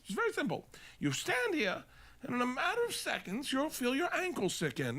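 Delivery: high-pitched mock English demonstrator; put-on English accent, explaining step by step; bad English accent. Man in England demonstrating quicksand, high comic English accent. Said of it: "I'm bad with accents".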